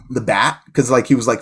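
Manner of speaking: ordinary talk, nothing marked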